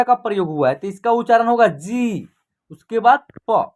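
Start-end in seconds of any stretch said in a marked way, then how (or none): 1.7-2.3 जी with a held ee; long vowel. Shown as a demonstration. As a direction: draw out the ī in जी. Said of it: "खींच करके होगा".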